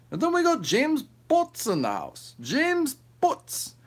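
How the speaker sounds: high, drawn-out ring announcer. Pretend. Streamer announces a viewer like a ring announcer, high and drawn out. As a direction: announce the name like a boxing MC.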